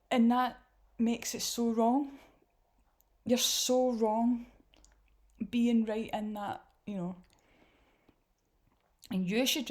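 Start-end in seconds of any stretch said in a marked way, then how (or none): 0.1-6.6 louder and slow, leaning on so wrong; slow emphatic scolding. Real, not acted. Commentator scolding the pastor, slow and emphatic. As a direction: drag out so wrong to hammer it home.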